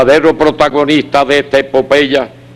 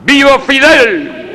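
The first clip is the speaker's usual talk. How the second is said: high rousing rally shout; ranting dictator speech; 10 semitones higher, slower than usual. Real, not acted. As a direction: shout the slogan to the crowd. Consignas gritadas al final. (consigna gritada con fervor)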